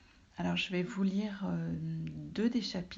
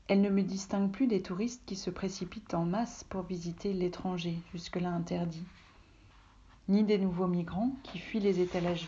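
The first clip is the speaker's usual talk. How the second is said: literary reading aloud; 5 dB louder than usual. A narrator's reading. Author reads her own literary text. (lecture littéraire posée, à voix haute)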